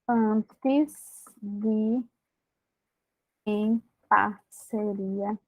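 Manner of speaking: slow, spaced dictation of the fix; walk them through it word by word. Shown as a demonstration. Teacher dictating the comma fix, slowly.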